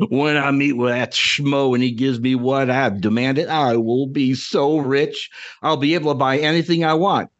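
put-on lightly Southern, cranky old prospector gloating; a crotchety old Southerner bragging about his riches; Southern crotchety old man. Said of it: "your Southern Ed Draw accent was perfect".

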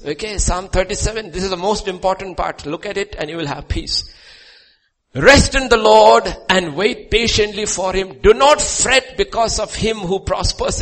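high, loud shouted sermon; fiery pulpit proclamation; preaching at full force. Real, not acted. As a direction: thunder the psalm to the congregation. Full-force preaching and psalm reading.

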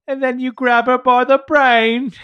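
loud, high, slow Cockney bellow; Cockney accent. Acted. Playing Ezra's brother for laughs, Cockney accent. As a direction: drawl it loudly in hammed-up Cockney. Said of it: "if his brother was like".

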